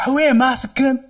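hammed-up slang greeting.

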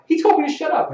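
very high, loud mimicked complaint; tattling player. Player telling the coach on the ref, high mock outrage. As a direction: squeal it like an outraged kid.

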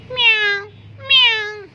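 high, loud meow; cat meow. Acted. Cat plays innocent before Barbie, high meow. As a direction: mew like an innocent kitty.

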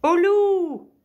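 high, very loud drawn-out yell; cri d'alarme. A narrator's reading. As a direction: cry wolf at full voice. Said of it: "il criait". (cri aigu et très fort)

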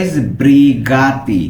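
"sbrigati" drawn out syllable by syllable; slow careful pronunciation. Shown as a demonstration. Teacher slowly modelling the word for learners. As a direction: stretch every sound of "sbrigati" very slowly. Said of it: "আমরা উচ্চারণটা কিন্তু ভালো মতো খেয়াল করবো".

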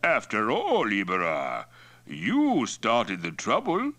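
slow, pointed figure voice; Mongol chief Toktai, reproachful. Acted. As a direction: chide him as the chief, pitch swinging high.

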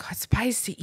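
breathy hushed whisper. Retold or imitated. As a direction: whisper it like a complaint. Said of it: "she's like".

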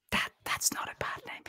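hushed whisper, imitating the boss; low-key whispered aside in someone else's role; confiding whisper. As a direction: whisper it like a private reassurance. Boss's confidential verdict on the nickname.

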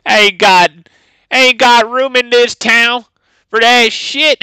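high, slow cowboy twang. Acted. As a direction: play a flamboyant cowboy, slow and loud. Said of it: "I'm gonna have to be using that line".

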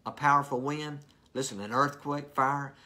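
booming, high and forceful; loud contrast to a whisper. Shown as a demonstration. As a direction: shout the big things. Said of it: "God was not in any of those".

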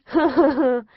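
high, loud burst of teasing laughter; loud mocking laugh. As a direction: cackle at her expense. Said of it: "started laughing his head off".

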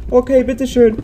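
put-on high meek old lady. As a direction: give in like a frail granny. Answers as the robbed old lady.

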